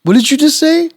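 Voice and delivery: high, loud incredulous question; acted-out astonishment; stunned disbelief. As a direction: demand a repeat in high-pitched shock. Acts out stunned reaction to news.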